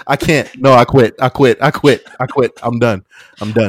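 real high, loud protest after cracking up; loud, worked-up surrender; loud giving up. Real, not acted. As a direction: blurt it out loud and high, quitting on the spot. Gives up the voice attempt, cracking up.

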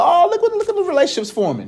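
high-pitched mock gushing; amused, hammed-up onlooker; playful teasing. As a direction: tease a budding couple in a high voice. Acting out teasing onlooker at couple.

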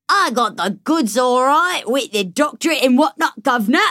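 badly faked Cockney accent; hammed-up British accent. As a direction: lay on a silly, bouncy London accent. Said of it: "stuck in that accent for the whole interview".

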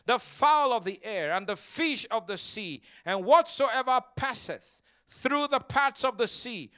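slow, loud scripture proclamation; preaching scripture. Acted. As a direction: proclaim each phrase to the congregation. Reads and declares scripture forcefully.

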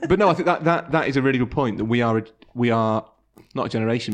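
ordinary talk

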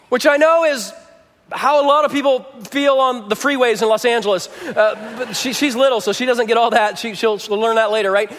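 ordinary talk

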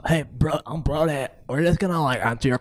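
surfer bro. Acted. Interviewer plays a surfer bro.

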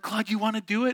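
high imitation of coaxing sisters. Sisters inviting their brother to the trust fall, coaxing.

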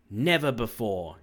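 loud, indignant outburst; raging cry. A narrator's reading. King crying out to the woodsman, enraged. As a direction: cry out in narrated rage. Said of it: "his voice trembled with rage".

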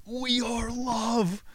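very high, long drawn-out wail; trippy exultant cry. Retold or imitated. Retelling his own trip, high drawn-out ecstatic cry. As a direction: stretch each word into a high mock proclamation.

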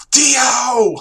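high, loud alarmed shout. Acted. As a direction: yell the name in alarm. Shouting a character's name.

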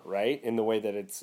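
usual speaking voice

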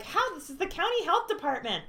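high, loud indignant retort. Retold or imitated. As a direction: snap back in disbelief. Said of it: "was like".

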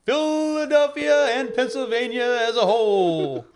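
slow, high boxing-ring announcer. Acted. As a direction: introduce them like a ring announcer. Announcer introducing guests on radio.

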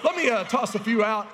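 little daughter voice. Retold or imitated. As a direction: play the eager kid with a laugh in it. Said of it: "or she goes".

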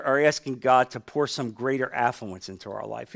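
ordinary talk

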